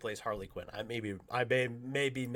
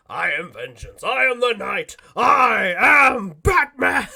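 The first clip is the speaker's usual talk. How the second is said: slow, louder, building to a booming hero cry; Batman line; 8 semitones higher, 14 dB louder, slower than usual. Retold or imitated. Conroy standing on the counter for the firefighters, building to a boom. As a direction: declaim it on the countertop like the Dark Knight. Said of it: "does it like an actor".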